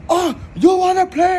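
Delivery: high, loud mock gangster yell; hammed-up Tony Montana shout. Tony yelling at the hitmen, high loud mock gangster shout. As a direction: belt it like the movie scene. Tony's famous line, shouted.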